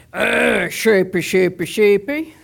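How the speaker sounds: low, growled-out sheep call; deep-voiced shepherd imitation. Thief calls sheep at the door, imitated deep voice. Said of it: "he has a real deep voice".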